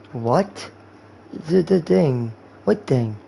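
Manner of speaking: ordinary talk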